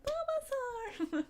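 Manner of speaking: put-on high, drawn-out childish squeal of the name; excited little kid. Small child on dad's lap picking a Pokemon, high squeal. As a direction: shout the pick like an eager small child. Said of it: "And I was like".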